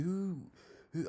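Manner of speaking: very high who who; hammed-up outraged disbelief; mock outburst. Losing it at the seller's friends, very high mock outburst. Losing temper at the French guys.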